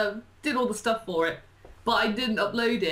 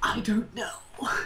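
whispered shrug. Real, not acted. Asked about an upload, she whispers uncertainly. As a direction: whisper it, unsure.

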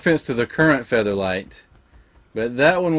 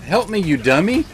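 loud, high call for help; frustrated yell; 7 semitones higher, 4 dB louder than usual. Real, not acted. Gamer yelling at his pet, frustrated shout. Creature not helping in fight.

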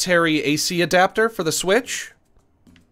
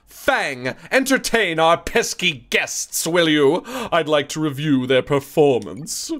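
put-on mid-Atlantic accent; slower than usual. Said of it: "She would definitely have a mid-Atlantic accent".

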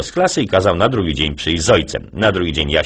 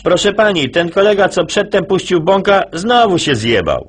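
put-on kid voice; 12 semitones higher than usual. Jasio complaining to teacher, high kid voice.

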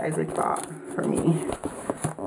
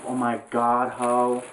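ordinary talk, nothing marked

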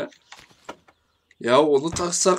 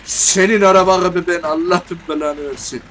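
loud, higher angry curse; real rage shout; furious cursing; 4 semitones higher, 8 dB louder than usual. Real, not acted. Cursing at broken game car.

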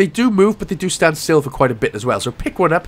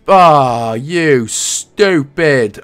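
drawn-out exasperated groan at the game; 6 dB louder, much slower than usual. Real, not acted. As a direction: stretch the insult out slowly in frustration. Botches a jump in the game.

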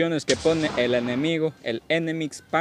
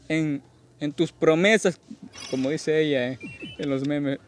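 ordinary talk

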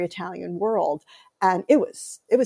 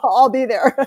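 half-laughing quote of herself, higher and louder; laughing mock reply; 4 semitones higher, 6 dB louder than usual. Telling her cousin how she answered the dinner chicken, laughing. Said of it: "And I'm like".

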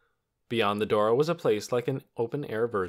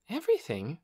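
puzzled question; 7 semitones higher than usual. A narrator's reading. Lena questions doctor's gloomy remark.

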